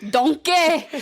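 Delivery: high, loud ogre call; hammed-up ogre impression; Shrek. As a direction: call out in a put-on cartoon ogre accent. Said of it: "does all the impersonations".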